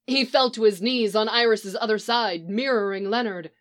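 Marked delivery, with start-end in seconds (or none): none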